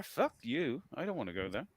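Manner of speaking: ordinary talk, nothing marked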